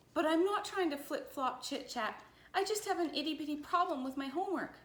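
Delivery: high-pitched, insistent little protest; protesting schoolgirl. A narrator's reading. Lulu explains her homework problem to Missus Bell, protesting.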